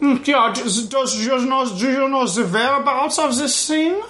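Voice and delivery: high, hammed-up German accent. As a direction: ask it like a sly German villain. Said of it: "It's like".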